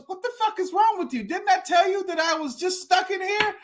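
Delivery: high-pitched exasperated disbelief; incredulous outburst. Retold or imitated. Stuck man to lady who jumped in, exasperated disbelief. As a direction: berate the would-be rescuer, voice pitched high. Man berates Linda for jumping in.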